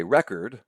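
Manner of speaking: record as the noun REC-ord; stress on first syllable. Shown as a demonstration. Lecturer explains the noun, record said REC-ord. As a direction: stress the start of record: REK-erd. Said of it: "the accent comes on the first syllable".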